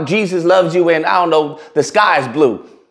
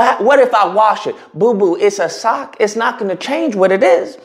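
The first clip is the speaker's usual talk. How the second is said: ordinary talk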